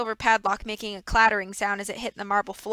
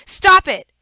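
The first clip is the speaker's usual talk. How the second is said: higher, much louder desperate cry; anguished shout; 10 semitones higher, 14 dB louder than usual. Acted. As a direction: scream at him to stop. Violet cries out at Stefano.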